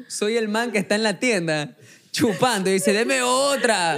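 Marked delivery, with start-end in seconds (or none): 2.9-3.9 high, drawn-out mock call; imitated customer, stretched words; drinker ordering more. Acts man drinking at the store.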